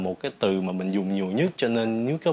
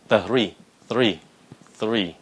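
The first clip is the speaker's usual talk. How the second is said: THREE blended quicker each time into one syllable; speeding up; 3 dB louder, much slower than usual. Shown as a demonstration. Teacher urging learners faster, tightening THREE. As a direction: squeeze th and ree together in THREE. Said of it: "Nhanh lên".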